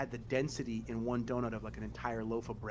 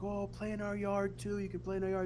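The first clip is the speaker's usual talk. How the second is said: neighbors inviting; 8 semitones higher than usual. Retold or imitated. Neighbors inviting the kids to play, high sweet mimic. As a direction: coo it like a kind old neighbor. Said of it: "They'd be like".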